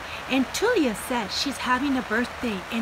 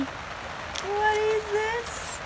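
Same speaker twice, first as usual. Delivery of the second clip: much higher, slow, curious; mock surprise; 10 semitones higher, much slower than usual. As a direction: gasp at what's inside. Pretend surprise at Froggy's mouth.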